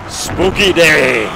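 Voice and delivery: low, drawn-out spooky voice into a cackle; mock-spooky horror host. Horror fan relishing a gloomy day, hammed-up ghoulish. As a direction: sink low and slow, then cackle. Repeats it, then monster-movie cackle.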